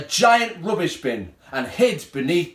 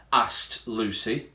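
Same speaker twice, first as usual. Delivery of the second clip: usual speaking voice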